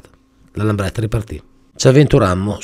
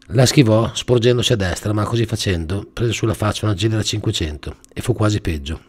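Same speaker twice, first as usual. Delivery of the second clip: plain reading voice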